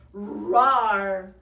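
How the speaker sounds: loud, rolling beast roar; monster roar. A narrator's reading. Bigfootosaurus looming over their heads, loud roar. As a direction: roar with all your might. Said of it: "he roared".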